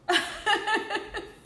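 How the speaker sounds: a high, embarrassed laugh through tears; tearful laugh. Real, not acted. Coach laughing at herself after tearing up, tearful laugh. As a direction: laugh it off after getting emotional.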